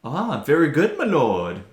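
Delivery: lightly voiced obsequious servant; a hint of a fawning courtier. Acting out a character's reply.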